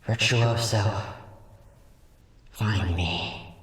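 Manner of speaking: a dying spirit clinging on; weakened earth spirit. Acted. The spirit, defeated, fading away.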